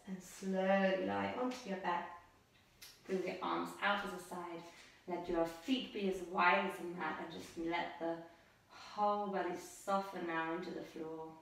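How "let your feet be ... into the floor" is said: normal talking voice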